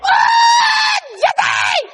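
high, loud mock squeal of delight; imitating his wife's happy squeal. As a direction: squeal his name like a thrilled wife. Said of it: "She goes".

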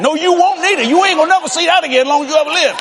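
high, fast mock scolding; scolding mother. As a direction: rattle off the telling-off. Mom scolding dad.

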